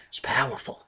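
breathy awed whisper. Acted. Preacher whispers to the congregation in awe. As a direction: hush it like a secret of awe.

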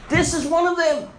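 high, loud accusing call; servant girl pointing out. Acted. Servant girl telling bystanders about Peter, high accusing call. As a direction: point him out to the crowd. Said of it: "began to say to those who stood by".

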